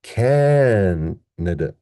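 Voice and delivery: "Canada" with a very long CAN, then quick weak nuh-duh; stressed first syllable. Shown as a demonstration. Teacher assembling the syllables, long CAN in "Canada", short schwas after. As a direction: stretch CAN in "Canada", slowly. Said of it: "let's put it all together".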